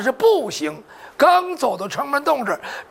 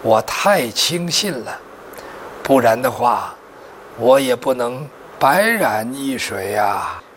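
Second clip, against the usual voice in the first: dying man's words; 13 semitones lower than usual. Acted. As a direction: force out a last apology, low and labored. Dying He Tianbao apologises to his lord. (临终遗言，低沉缓慢)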